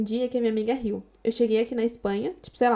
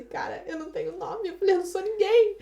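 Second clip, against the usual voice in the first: retold crying; 7 semitones higher, 3 dB quieter than usual. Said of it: "comecei a chorar".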